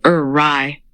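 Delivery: ordinary delivery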